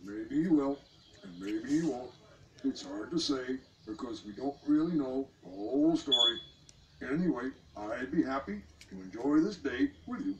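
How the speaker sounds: lightly voiced frog, reassuring; frog consoling. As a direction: gently cheer her up.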